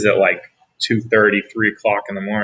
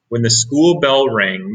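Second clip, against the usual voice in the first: plain reading voice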